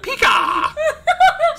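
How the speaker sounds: very high, loud squawk; mock Pikachu cry. Player imitating surprise Pikachu, high mock shout. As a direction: shriek it like a cartoon attacker.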